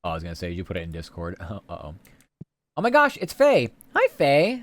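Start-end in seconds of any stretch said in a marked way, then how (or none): 2.8-3.7 excited gasp. Real, not acted. Streamer spots a friend arriving. 3.9-4.6 high, bright-eyed hello; excited greeting. Real, not acted. Greeting friend Faye in chat.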